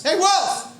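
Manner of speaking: teasing friend calling up to him, high and laughing; buddy calling out. Retold or imitated. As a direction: sing out your friend's arrival like a teasing kid. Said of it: "he goes".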